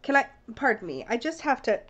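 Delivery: usual speaking voice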